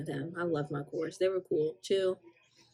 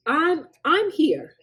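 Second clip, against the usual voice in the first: slow, higher, louder "I'm, I'm here"; drawn-out annoyed "I'm here"; 8 semitones higher, 5 dB louder, much slower than usual. Retold or imitated. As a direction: stretch out "I'm here" like she's fed up. Re-acting her own quote slowly.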